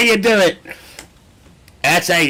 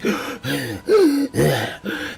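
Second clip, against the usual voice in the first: dramatic gasp. Retold or imitated.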